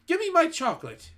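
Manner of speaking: put-on a boy insisting on his chocolate; boy demanding from the witch, high and loud. A narrator's reading.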